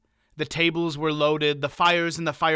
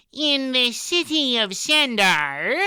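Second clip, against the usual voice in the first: Eilbrig, much higher and louder, nasal; high-pitched nasal voice; 10 semitones higher, 5 dB louder, slower than usual. A narrator's reading. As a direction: pinch it up high through the nose. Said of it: "in his rather high-pitched nasal voice".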